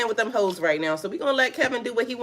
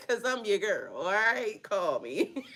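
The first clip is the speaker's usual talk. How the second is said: soft flirty aside; 9 dB quieter, slower than usual. Pretend.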